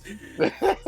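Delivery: laughing. Real, not acted. Giggles mid-line while reading.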